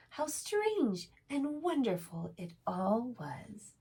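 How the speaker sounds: gentle storybook reading voice; reading to children. A narrator's reading. Reads a picture book aloud.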